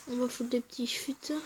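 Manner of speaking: whispered under the breath; hushed whisper, almost to self. Real, not acted. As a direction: mutter it to yourself as you play. Quietly placing cards mid-match.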